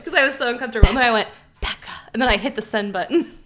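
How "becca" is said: soft breathy whisper of the name. Retold or imitated. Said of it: "Tell us where you whisper your name".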